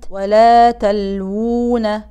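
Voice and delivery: ولا تلوون slow with the second waw drawn two counts; slow recitation demo. Shown as a demonstration. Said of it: "واو صغيرة مرسومة". (مد حركتين)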